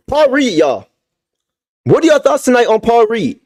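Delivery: ordinary delivery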